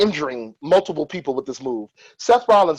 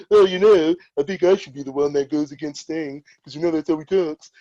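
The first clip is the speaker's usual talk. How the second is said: Triple H. Acted. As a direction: lightly imitate a wrestler lobbying his boss. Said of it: "great impression of Triple H".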